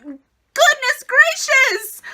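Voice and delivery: slow, high, stretched exclamation; goodness gracious. Real, not acted. Reviewer stunned by the episode news, slow and high. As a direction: draw it out in amazement. Reacting to press release.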